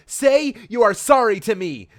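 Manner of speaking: mock shout. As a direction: bellow a melodramatic demand. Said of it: "shouted Sonic at Amy".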